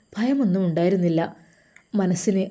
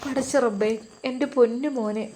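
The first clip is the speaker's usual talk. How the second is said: higher, tearful lament to God; acted weeping mother, pitched up; mother crying; 4 semitones higher than usual. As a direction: cry out for her son in distress. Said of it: "ഉമ്മ കരയുകയാണ്".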